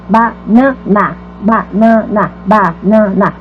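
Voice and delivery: "banana" devagar, sílaba por sílaba: ba-na-na; separado em sílabas. Shown as a demonstration. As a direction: dizer banana mais grave, com pausa entre as sílabas. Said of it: "É uma sílaba na banana". (banana silabado pausadamente)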